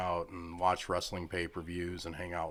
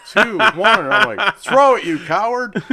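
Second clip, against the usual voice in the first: loud, high, laughing egging-on; laughing shout; 18 dB louder than usual. Real, not acted. Dad urging the boy to throw the glow stick, laughing shout. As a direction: crack up while yelling him on.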